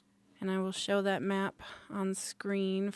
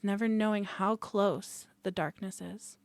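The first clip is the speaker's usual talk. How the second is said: usual speaking voice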